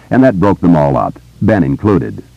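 usual speaking voice

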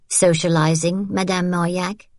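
lightly arch baker's wife, teasing; a sly, knowing jab. Acted. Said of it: "Hélène said archly".

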